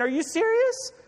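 acted exasperation, pitched well up; rally doubters in high, exasperated preacher's voice; frustrated leader. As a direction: play a leader at his wits' end. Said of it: "frustrated as a leader".

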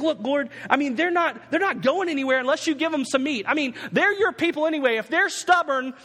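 high, exasperated mock protest; ranting pity party. Preacher playing Moses arguing with the Lord, exasperated. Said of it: "he's having this party".

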